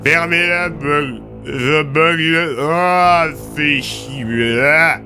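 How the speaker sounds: high, slow, garbled drooling groans; loud slobbering, half-formed curses; drugged slurring. Acted. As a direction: struggle to articulate through a numb mouth. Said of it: "avait un mal fou à articuler. Il bavait abondamment".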